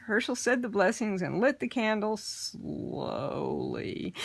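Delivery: plain reading voice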